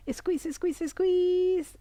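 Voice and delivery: urgent high coaching chant, swelling; straining squeeze cue. Shown as a demonstration. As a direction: pump it up high, holding the last one. Last squeeze of the exercise.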